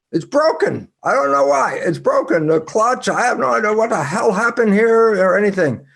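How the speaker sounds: high, rushed panic over a broken car; panicked caller. Retold or imitated. Friend calling from town in a high panic. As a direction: blurt it out, lost and helpless.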